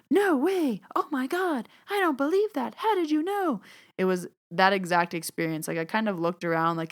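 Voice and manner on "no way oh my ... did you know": very high, astonished squealing disbelief; imitating a gobsmacked reality show reaction; stunned TV guest. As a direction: play the shocked grocery store woman on TV. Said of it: "the person's like".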